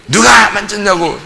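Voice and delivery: frowning teacher's shout of the question; stern shout. Retold or imitated. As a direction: bark it out with a frown. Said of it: "인상 팍 쓰고 얘기하니까".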